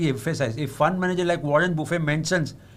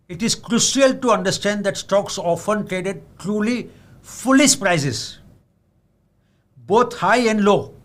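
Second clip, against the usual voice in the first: foolish prices stretched slow and louder; stressed quote; 3 semitones higher, 4 dB louder, slower than usual. Shown as a demonstration. Reading Buffett's quote to investors, slow and emphatic. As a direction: lean hard on "foolish prices". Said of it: "I again want to high emphasize".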